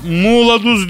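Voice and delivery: "Muğla Duz" as a drawn-out dialect garble; mock a regional speaker stretching "Muğla Duz"; mispronounced mıknatıs. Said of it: "mıknatıs diyemiyor".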